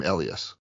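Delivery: Elias as ELL-ee-us; first-syllable stress. Shown as a demonstration. Host refusing the corrected name, first-syllable stress. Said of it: "cannot bring myself to call him".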